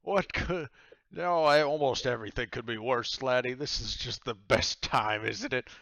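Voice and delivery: loud, hearty tavern captain; big, loud character voice for a merry drinker; boisterous captain. Acted. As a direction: boom it out jovially. Game master voices boisterous captain.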